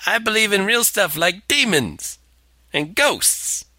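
high, scoffing know-it-all; mock smug believer. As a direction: play the smug ghost hunter. Mocking the ghost hunter.

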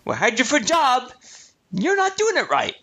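high-pitched mock employer voice; put-on scolding boss. Quiz host hinting as a disgruntled employer, mock scolding.

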